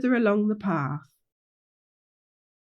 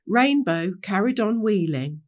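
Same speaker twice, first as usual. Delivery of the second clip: usual speaking voice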